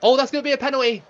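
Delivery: a sudden high, loud, fast cry at a foul; excited yelp. Real, not acted. Streamer reacting to the foul, yelping high, loud and fast. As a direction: blurt it out, high and quick, the moment the foul lands.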